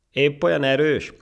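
high, loud, derisive question; mocking. Monkey taunting the ant about strength, mocking. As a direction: jeer at the boast. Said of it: "kérdezte gúnyosan a majom".

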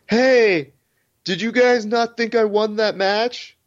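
high-pitched mocking call to the crowd; imitating AJ taunting crowd. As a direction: ham up a cocky fighter asking the crowd. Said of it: "he goes".

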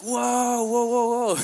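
very high, loud, drawn-out whoa; amazed whoa. Retold or imitated. Prisoners amazed at the cut foreign hair, loud high whoa. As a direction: marvel loudly, stretched high.

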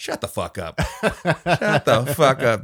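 slow, loud, laughing; laughing mock yell. Friend reacting to the story, laughing loudly. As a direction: laugh through it. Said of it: "laughing repeat".

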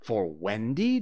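appalled, questioning repeat; aghast. Acted. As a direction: echo it back in disbelief.

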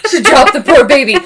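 loud, high, laughing shock; laughing in disbelief. Real, not acted. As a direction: crack up at what you just said. Shocked at own words, laughing.

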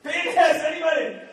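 high, loud yell at the room; playful shout. Acted. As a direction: shout it to start the game. Playing the penis game with crowd.

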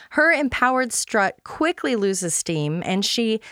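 usual speaking voice

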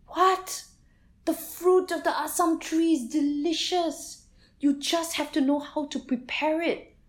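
high, loud disbelieving voice; astonished outcry. A narrator's reading. Jelutong man to villagers, high astonished disbelief. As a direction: gasp in disbelief, then explain. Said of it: "said the Jelutong man".

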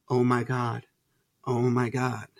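low, hushed disbelief; stunned realisation. Retold or imitated. Husband reliving his discovery of narcissism online, quietly stunned. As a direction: let it sink in, soft and low.

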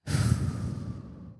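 a long unvoiced sigh, letting it out; long shaky sigh. Real, not acted. Podcaster steadying herself before hard news, deep sigh. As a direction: exhale slowly to steady yourself. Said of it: "just taking a breath".

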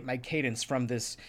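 ordinary delivery

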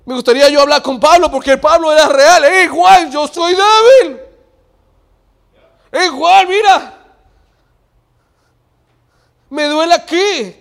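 high, shouted acted confession; impassioned preaching. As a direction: cry it out at full preaching force. Preaching, acting out Paul confessing. (predicación a gritos, confesando con fuerza)

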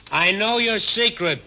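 speaking up. Acted. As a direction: say it up and out so she hears. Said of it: "Speak up, young man".

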